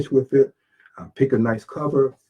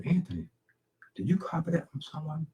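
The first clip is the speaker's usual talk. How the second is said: higher, hushed question to himself; soft self-questioning; 5 semitones higher, 10 dB quieter than usual. Retold or imitated. Author doubting his own writing, soft and higher self-questioning. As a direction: ask yourself softly, pitched up.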